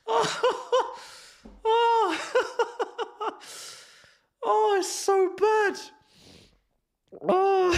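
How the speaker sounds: squeaky, high words through hysterics; tearful, breathless laughing wail; crying with laughter. Real, not acted. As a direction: keep collapsing into helpless laughter mid-sentence. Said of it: "I'm actually crying".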